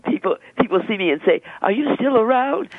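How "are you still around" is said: high-pitched imitation of amazed acquaintances; incredulous retold question, lifted high; surprised question. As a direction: pitch it up in playful mock disbelief. Acts out people's surprised question.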